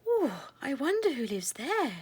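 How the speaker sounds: lightly voiced curious little mouse; a little girl curious, mild; Primrose wondering. A narrator's reading. Primrose spots the round house, light curious voice. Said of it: "she thought".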